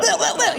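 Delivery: high, silly bleh noises; imitated spurting noise. Imitates boobs leaking milk.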